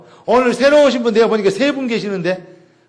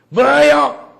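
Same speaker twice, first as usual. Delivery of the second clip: higher-pitched retort, acting out an offended listener; mock indignant outburst; 6 semitones higher, 4 dB louder, much slower than usual. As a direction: snap back as if insulted. 따지는 상대 역 재연. (발끈해서 되묻는 흉내)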